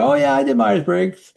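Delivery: high, airy brag; breezy claimer. Retold or imitated. Someone boasting about a personality test, breezily. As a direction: toss it off like a proud amateur. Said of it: "Someone said".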